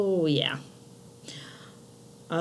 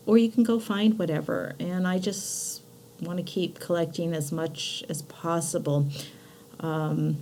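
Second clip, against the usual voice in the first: ordinary talk, nothing marked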